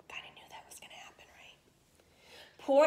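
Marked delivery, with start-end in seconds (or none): 0.1-1.6 a quick, soft whisper; whispered aside. Real, not acted. Teacher to listening kids, a quick conspiratorial whisper. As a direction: let the class in on a secret.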